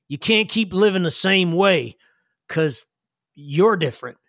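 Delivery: folksy character voice, halting and earnest; Sam. Acted. Sam advises Jillian on powers, folksy and earnest. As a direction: reason it out in plain country talk. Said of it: "and he says".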